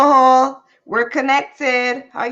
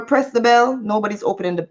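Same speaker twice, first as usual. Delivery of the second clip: ordinary delivery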